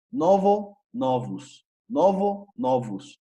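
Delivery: high novo, then novus dropping, ending in "us"; plural O as U. Shown as a demonstration. Teacher contrasts singular and plural, novus ending in U sound. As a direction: novo with final O, novus with a half-closed U. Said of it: "como si esa segunda O fuese una U".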